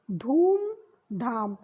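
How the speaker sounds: धूम धाम said slowly, long ऊ in धूम; correct pronunciation. Shown as a demonstration. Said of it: "धम धाम नहीं होता है". (धूम धाम — धीरे, धूम का ऊ लंबा)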